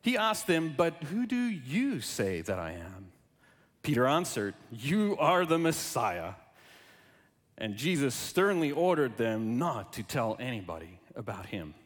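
measured, formal Bible reading; scripture reading. Acted. Reads Gospel aloud before sermon.

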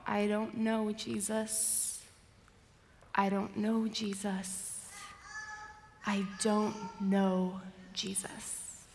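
soft, broken-up denial fading away; hushed denial. Acted. Peter denies Jesus as his world falls apart, hushed. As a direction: repeat it quietly, slowing and sinking to near whisper. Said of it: "cries out".

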